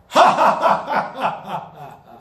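a loud, scoffing laugh; scornful laugh. Acted. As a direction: laugh them off with contempt. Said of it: "The Lord laughs".

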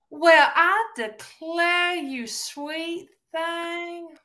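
very drawn-out, high sugary drawl; polite Southern insult. Acted. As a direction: gush with fake Southern sweetness, stretching every word. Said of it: "We're very polite about it".

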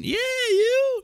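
very high, drawn-out caricature; put-on high heckler voice; mock tough-guy comeback. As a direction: squeal the comeback in jest. Joins the tough-guy bit.

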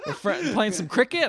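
high, laughing question. Real, not acted. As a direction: giggle through the guess. Mocking the beach scene.

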